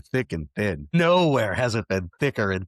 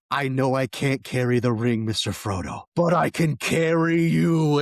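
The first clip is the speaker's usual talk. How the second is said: heartfelt cry rising higher and louder; rally a friend, building to a big heroic lift; impassioned movie-hero quote; 4 semitones higher, 4 dB louder than usual. Acted. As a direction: act out a stirring film line. Acting out Sam's volcano rallying cry.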